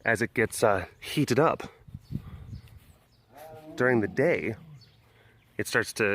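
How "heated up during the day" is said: usual speaking voice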